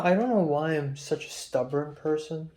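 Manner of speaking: normal talking voice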